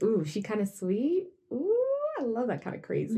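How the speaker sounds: smitten admirer. Pretend.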